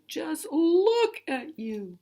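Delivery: very high, loud, slow scolding cry. Shown as a demonstration. Teacher showing how bold words are read, high loud scold. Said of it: "say those words a little bit louder".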